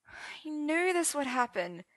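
high, slow mournful sigh. A narrator's reading. Arthur washing his tear-streaked face, slow high sigh. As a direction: sigh it out in resignation. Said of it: "Arthur sighed".

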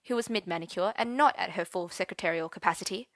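plain reading voice

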